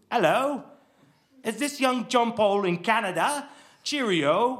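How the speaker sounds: put-on father faking a British accent on the phone. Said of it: "he did sound like my father, but an accent".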